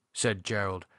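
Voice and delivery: plain reading voice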